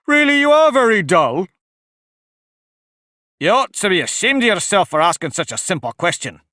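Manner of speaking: scornful Griffin. A narrator's reading. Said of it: "added the Griffin".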